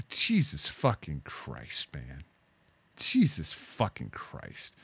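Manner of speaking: slow, low, quiet dismay; real weary exasperation, drawn out; exasperated disbelief. Real, not acted. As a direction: mutter it slowly, drained by disbelief. Dismayed reaction to the clip.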